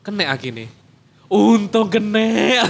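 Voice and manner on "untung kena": loud, higher, drawn-out relief; relieved exclamation. Real, not acted. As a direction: burst out at the lucky outcome. Relieved punchline of battery story. (lega banget, suarane banter)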